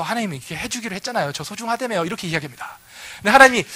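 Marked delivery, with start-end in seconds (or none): 0.0-2.0 meek pleading. Acted. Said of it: "뒤로 물러서서".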